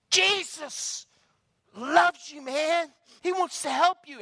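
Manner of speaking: high, loud declaration of love; loud preacher insistence; bold proclamation. Retold or imitated. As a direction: hurl it right back at him. Friend provoked, proclaims boldly.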